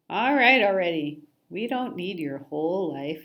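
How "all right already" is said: loud exasperated interruption. Acted. Fed-up fish interrupting.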